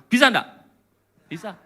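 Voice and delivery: ordinary delivery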